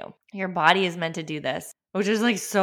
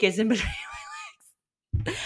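laughing; 3 semitones higher, slower than usual. Real, not acted. Laughs while naming baby.

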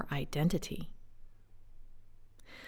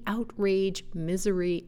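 ordinary talk, nothing marked

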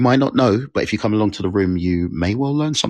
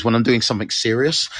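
ordinary delivery

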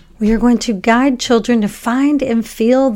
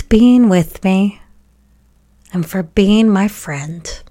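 guided meditation; 2 semitones higher than usual. Acted. Podcast host guiding children to thank heart, brain and body, guided meditation. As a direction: guide children gently through it, leaving room to breathe.

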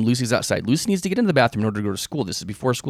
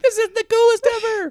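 much higher, squealing mock delight; high, hammed-up excitement; mock gushing; 5 dB louder than usual. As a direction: gush like a thrilled teen. Voicing her reply to emoji.

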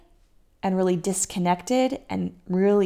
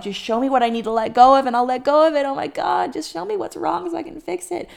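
mock panic; 7 semitones higher, 5 dB louder, faster than usual. Panicking woman voiced.

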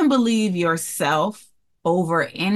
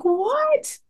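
a much higher, squealed what; excited what. Retold or imitated. Mother hearing her daughter got into college, delighted shock. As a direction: shriek it in happy disbelief. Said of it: "And she was excited".

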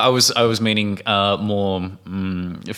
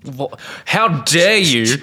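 hammed-up indignation, slowly; mock outrage; 4 semitones higher, much slower than usual. Australian host pretending to be offended, mock outrage.

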